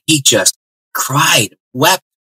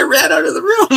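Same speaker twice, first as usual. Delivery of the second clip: real laugh through the words, much higher. Real, not acted. Telling the host he fled the room, real laughter. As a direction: laugh it out as you tell it.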